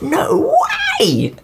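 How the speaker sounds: high shocked "no way"; shocked shout. Retold or imitated. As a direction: shout it in disbelief. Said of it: "and I'm like".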